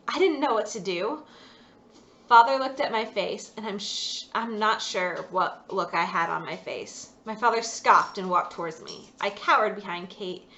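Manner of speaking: usual speaking voice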